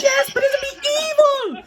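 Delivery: very high mock-hysterical cry; flipping out. Neighbor warning him about the spirit dog, flipping out. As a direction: imitate the neighbor shrieking a dire warning. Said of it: "she's flipping out".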